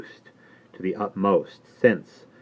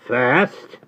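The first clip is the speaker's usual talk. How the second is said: Jerry Cruncher; 6 semitones higher, 5 dB louder than usual. Acted. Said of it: "said Mister Cruncher".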